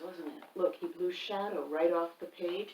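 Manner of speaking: usual speaking voice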